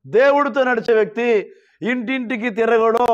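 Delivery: normal talking voice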